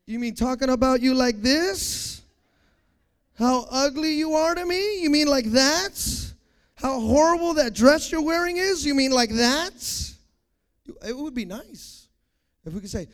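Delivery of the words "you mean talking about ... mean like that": loud, rising questions with drawn-out endings; mock innocent taunting. People told to stop talking about you, mock innocent taunting. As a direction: taunt while pretending to ask. Acts out people insulting on purpose.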